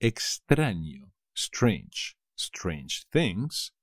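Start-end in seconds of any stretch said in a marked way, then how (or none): none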